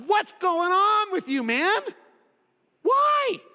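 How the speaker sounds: high, loud, pleading why; grieving believer calls out to God, high and pained; anguished complaint. Retold or imitated. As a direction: throw the question at God, hurt and loud.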